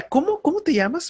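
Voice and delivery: high, puzzled asking of a name; confused announcer. Retold or imitated. Announcer on air, unsure of a listener's name, puzzled. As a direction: play the announcer who can't read the name. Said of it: "De repente nos escribe alguien".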